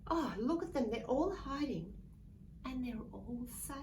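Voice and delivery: plain reading voice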